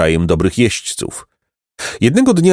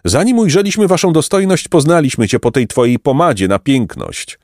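higher, teasing flattery; cheeky boy; 7 semitones higher than usual. Acted. Jacek teasing the count about his pomade, cheeky. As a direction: needle the count politely.

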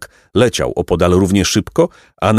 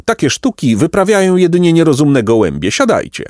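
pelican's yell; 8 semitones higher than usual. A narrator's reading. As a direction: shout down the silly pigeons. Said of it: "wrzasnął Pelikan".

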